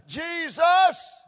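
high, loud shout of Jesus. Acted. Preacher shouting the name to the congregation, loud. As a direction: cry his name out with full force. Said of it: "I don't care what anyone says".

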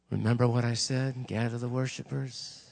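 a kind, low-pitched prompting; God's gentle nudge. Retold or imitated. God waking the preacher at five, gentle and low. Voices God nudging at dawn.

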